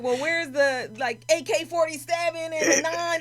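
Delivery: high, loud mock disbelief; laughing, half-joking disbelief; incredulous. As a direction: act amazed there's no gun. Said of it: "And I'm like".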